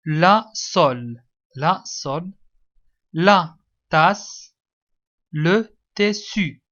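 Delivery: slow, word-by-word dictation. Shown as a demonstration. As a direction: dictate firmly, each piece set apart. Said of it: "écris les mots sous les images". (إملاء بطيء ومتقطع)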